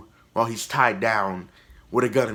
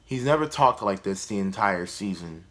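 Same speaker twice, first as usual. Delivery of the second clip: ordinary talk, nothing marked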